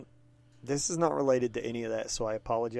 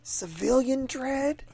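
much higher, slow disbelieving question; incredulous; 12 semitones higher, slower than usual. Real, not acted. Friend stunned that the judge's clone became a civilian. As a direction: stretch it out in mock amazement.

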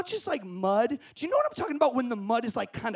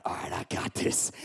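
lower, soft whispered pep talk; murmured self-talk; 7 dB quieter than usual. Retold or imitated. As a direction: whisper to yourself before the run. Psyching himself up quietly.

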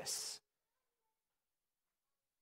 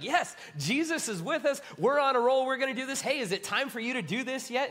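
higher and louder, eager and pumped; fired-up disciples; 8 semitones higher, 4 dB louder than usual. Retold or imitated. Disciples asking Jesus, higher and excited. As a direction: play the disciples itching to go. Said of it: "They're like".

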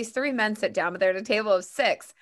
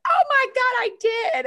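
very high, loud squeal; squealing admission; 7 dB louder than usual. Retold or imitated. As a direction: squeal it, caught out and amused. Birthday lady laughing at herself.

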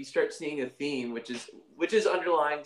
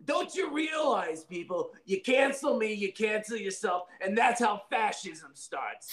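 Dino Dad voice; 5 semitones higher than usual. Acted. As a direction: rant like an offended cartoon dad. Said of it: "talk about it in Dino Dad's voice".

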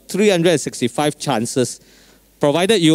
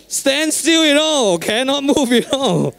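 excited; 11 semitones higher than usual. Real, not acted. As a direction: voice jumps high with excitement. Excited retelling of jam.

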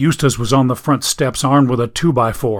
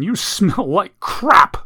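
slow tearful outburst; 7 semitones higher, 3 dB louder, slower than usual. Acted. Ellie crying, hugging Eustace.

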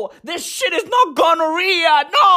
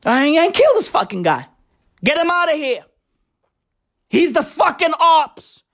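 ordinary delivery

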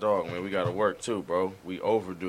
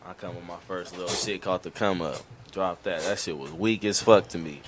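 ordinary talk

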